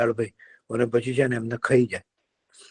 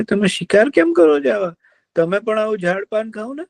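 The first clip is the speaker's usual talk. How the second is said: kind deer; 10 semitones higher than usual. Acted. Deer cheers up the sad fox, coaxing. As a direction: soothe him like a gentle deer.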